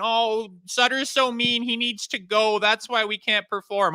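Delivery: mocking whiny player.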